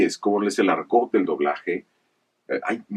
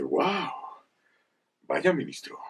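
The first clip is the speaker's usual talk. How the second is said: Kingsley Shacklebolt line. Shown as a demonstration. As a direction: voice the wizard, lightly awed. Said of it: "Kingsley Shacklebolt". (un toque de mago asombrado, más agudo)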